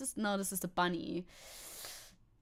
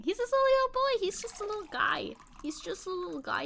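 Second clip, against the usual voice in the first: doting coo; 9 semitones higher, 3 dB louder than usual. Real, not acted. Streamer dotes on her dog to chat, high coo. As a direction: coo over the little guy.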